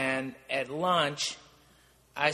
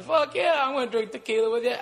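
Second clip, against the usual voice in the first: much higher, louder, fast eager shout; excited yes; 9 semitones higher, 3 dB louder, faster than usual. Retold or imitated. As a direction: jump at the offer, fast and high. Eager reply to tequila offer.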